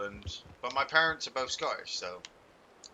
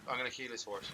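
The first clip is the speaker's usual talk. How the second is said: usual speaking voice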